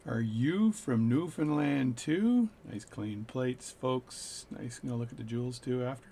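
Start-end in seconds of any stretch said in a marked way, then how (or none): 0.1-2.5 speaking really slow. Retold or imitated. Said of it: "speak really slow".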